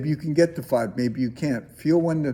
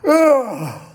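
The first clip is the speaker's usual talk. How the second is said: loud yawning ah, higher; real yawn sound; 9 semitones higher, 7 dB louder than usual. Shown as a demonstration. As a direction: show them a real unstifled yawn. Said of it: "A real yawn".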